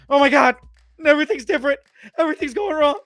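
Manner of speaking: a guy losing it as everything goes wrong; nervous breakdown. Retold or imitated. Barry losing it over a changed world, high panicked voice. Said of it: "starts having a nervous breakdown".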